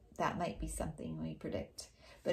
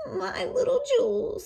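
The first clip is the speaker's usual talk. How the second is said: put-on much higher sweet old-woman voice; wolf as grandma; 7 dB louder than usual. Disguised wolf coaxing the children at the door, high and sweet. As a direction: coax like a fake granny.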